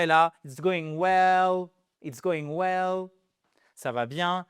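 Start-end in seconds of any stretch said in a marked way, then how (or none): 0.4-3.1 slow, careful teacher demo; slow English demo for French learners; slow model phrase. Shown as a demonstration. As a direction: spell it out slowly for learners. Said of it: "teacher models phrase slowly".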